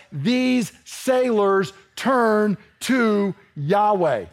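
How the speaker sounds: very slow, each word set apart; deliberate word-by-word emphasis; emphatic. Shown as a demonstration. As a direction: underline every word of "these sailors turn to Yahweh" with pauses. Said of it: "they would have bolded, italicized it, underlined it".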